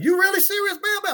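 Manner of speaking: stunned cowboy asking if he means it; incredulous cowboy. Retold or imitated. Cowboys shocked at his offer.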